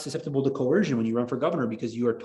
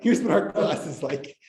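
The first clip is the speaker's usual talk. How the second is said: mock promo voice; 3 semitones higher than usual. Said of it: "set that as a promotional video".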